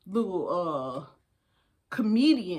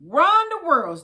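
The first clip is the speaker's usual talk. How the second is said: higher, louder echo of the line; rousing repeat; 6 semitones higher, 6 dB louder than usual. Shown as a demonstration. Firing up the listeners, a short rally echo. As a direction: punch it like a rally cry. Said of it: "Repeat after me".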